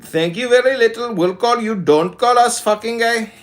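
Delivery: put-on dismissive caller in an Indian accent; horrible Indian accent. Prank caller dismissing a stranger, horrible Indian accent. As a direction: brush them off in a hammy Indian accent. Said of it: "Oh, that's a horrible accent".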